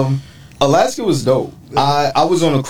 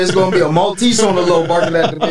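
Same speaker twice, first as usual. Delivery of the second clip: fast, higher, laughing through the joke; laughing tease; 6 semitones higher, faster than usual. Real, not acted. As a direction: rattle it off while cracking up. Joking about Maltese, laughing.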